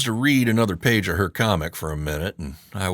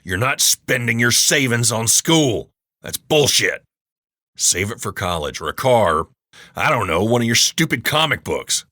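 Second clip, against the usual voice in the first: loud, growling rebuke; angry growl; 4 semitones higher, 5 dB louder than usual. A narrator's reading. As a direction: slam the table and snarl. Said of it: "I practically growl at her".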